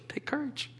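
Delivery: soft unvoiced whisper. Real, not acted. Preacher whispers encouragement to his listeners. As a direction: breathe it like a secret encouragement.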